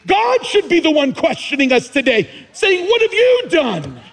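preaching at full force. Acted. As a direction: preach it to the rafters.

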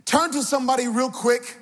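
high, rousing sermon delivery; impassioned preacher's call; preaching. Real, not acted. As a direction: exhort the congregation in rhythmic, falling phrases. Preaches with raised voice.

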